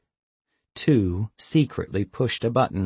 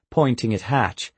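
plain reading voice

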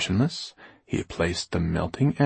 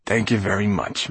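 ordinary delivery

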